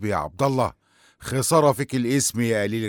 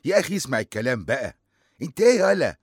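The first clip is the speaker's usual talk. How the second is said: much higher, louder furious scold; angry scolding; 9 semitones higher, 6 dB louder than usual. Acted. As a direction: tear into the boys. Sheikh scolding the boys.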